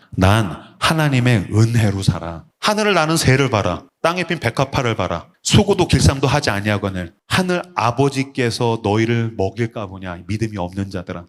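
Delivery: calm scripture voice. Pretend. Preacher voicing a believer answering the world, calm and low. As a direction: speak the promise gently and low. Quoting Jesus' words to believers.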